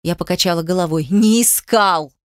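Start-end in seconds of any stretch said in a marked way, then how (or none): 1.1-2.1 stretched, emphatic contradiction; firm denial. A narrator's reading. Heroine shakes her head at Demyan, firm denial. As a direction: throw the denial back slowly and firmly.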